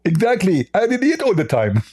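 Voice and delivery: high, lisping comic boast; Zakir Naik. Acted. Mock preacher boasting to the host, high and lisping. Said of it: "Hey, Zakir Naik".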